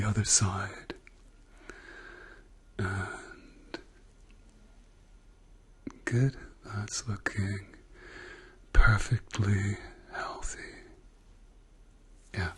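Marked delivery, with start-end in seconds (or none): 2.8-12.5 whispering. Acted. ASMR doctor exam roleplay.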